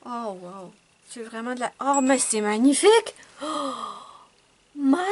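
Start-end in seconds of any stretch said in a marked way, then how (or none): 1.8-5.0 loud, thrilled praise; delighted exclamation. Real, not acted. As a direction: gush over the colour.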